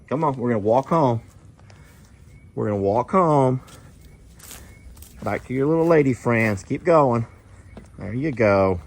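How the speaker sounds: sweet-talking an animal to follow him; coaxing the bull. Real, not acted.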